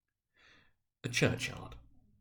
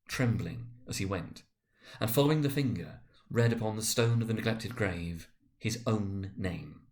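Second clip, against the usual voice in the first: usual speaking voice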